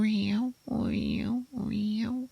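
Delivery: high, soft whining meows; thin, high ringing swirls, repeated softly; imitated spoon sound. Storyteller mimics spoon stirring in teacup, high soft imitated sound. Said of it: "it's going like".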